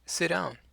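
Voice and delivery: "Sit down" run together as "si-down" with no T; lighter, T dropped. Shown as a demonstration. Teacher showing the relaxed everyday "Sit down". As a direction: let the T in "Sit down" vanish. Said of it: "so it's just".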